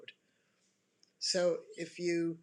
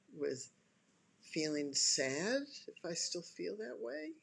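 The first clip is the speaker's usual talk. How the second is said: calming self-talk; 3 semitones lower than usual. Shown as a demonstration. Person choosing to calm anger down, low and unhurried. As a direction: talk yourself down gently, pausing between thoughts. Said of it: "what you'd experience in just listening to me".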